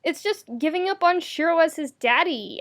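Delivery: ordinary delivery, nothing marked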